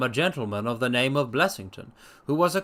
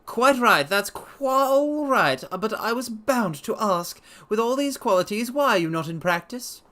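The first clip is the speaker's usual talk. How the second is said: Blessington voiced; 8 semitones higher than usual. A narrator's reading. Blessington probing the doctor's habits, high sharp voice. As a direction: poke at him, high and sharp. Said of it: "Blessington continues".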